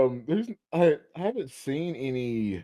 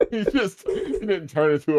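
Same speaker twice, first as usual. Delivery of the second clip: laughing; 7 semitones higher than usual. Real, not acted. Laughing mid-story about ghost.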